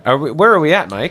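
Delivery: normal talking voice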